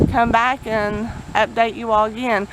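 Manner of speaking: ordinary talk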